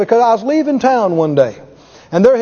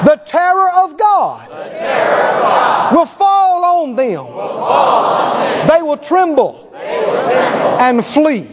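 higher, slow proclamation building to a shout; preaching declaration; 3 semitones higher, slower than usual. Real, not acted. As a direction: declare it with power from the pulpit. Preacher declaring at full force.

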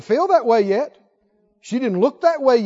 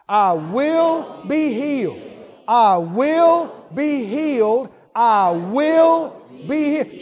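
very slow, firm, stress on will; slow repeated affirmation; much slower than usual. Shown as a demonstration. Preacher leading the congregation's repeated vow, slow and firm. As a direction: lean on will each time, unhurried. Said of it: "I want you to say that out loud two or three times".

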